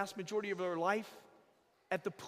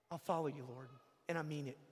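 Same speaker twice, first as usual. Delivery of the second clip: soft, hushed promise; mean every word, quietly; a believer answering the Lord in earnest; quiet sincere vow; 8 semitones lower, 7 dB quieter, faster than usual. Pretend. A heartfelt answer to God.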